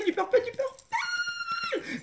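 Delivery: high, slow squeal of delight; ecstatic shout. Real, not acted. Vlogger squealing at her new purple mascara. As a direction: cry out the name in pure joy. Excited unboxing cry of joy.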